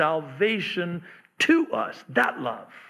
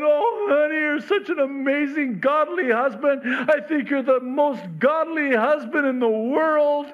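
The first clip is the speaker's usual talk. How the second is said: gushy wife; 10 semitones higher than usual. Retold or imitated. Imagined wife gushing over her husband, high and gushy. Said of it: "all gushy and mushy".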